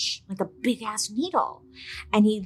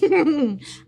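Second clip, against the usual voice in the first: high burst of giggling; short giggle; 8 semitones higher, 5 dB louder than usual. Real, not acted. Host tickled by own alien daddy joke, giggling. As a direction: laugh at your own joke.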